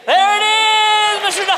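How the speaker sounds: loud, high triumphant announcement; excited reveal. Real, not acted. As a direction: call it out to the crowd with delight. Reveal of the right father.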